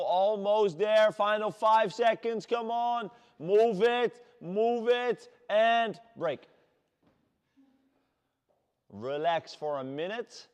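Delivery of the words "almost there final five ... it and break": coach's shouting. Real, not acted. Coach counts down final seconds.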